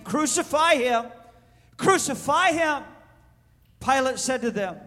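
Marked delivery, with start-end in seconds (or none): 0.0-2.8 high mock crowd cry. A narrator's reading. Chief priests and officers seeing him, crying out. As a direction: call it out high, a moderate shout. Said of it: "they cried out, saying".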